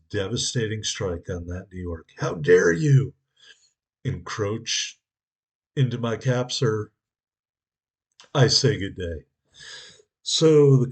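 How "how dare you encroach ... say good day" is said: loud, hammed-up offended aristocrat; mock indignant gentleman. Captain scolding the enemy ship, loud mock outrage. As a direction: play the outraged gentleman chasing off an intruder.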